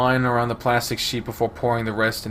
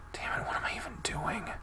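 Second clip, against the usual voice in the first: softer exasperated whisper to herself; frustrated whisper; 10 semitones higher, 10 dB quieter than usual. Acted. Girl catching herself mid-prayer, whispering frustrated. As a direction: hiss it under your breath in frustration. Frustrated aside while praying.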